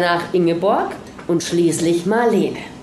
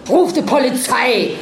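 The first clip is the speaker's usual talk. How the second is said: sharp order; 4 semitones higher than usual. A narrator's reading. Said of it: "herrschte sie ihn an".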